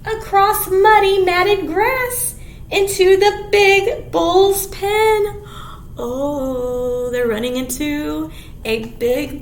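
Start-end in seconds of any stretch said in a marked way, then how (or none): 0.1-5.4 slow, louder storybook reading; reading to children. Acted. Teacher reading the picture book to kids. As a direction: read each phrase out with relish.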